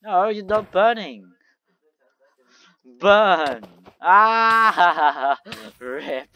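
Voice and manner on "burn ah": shouting. Real, not acted. Burning fight in game.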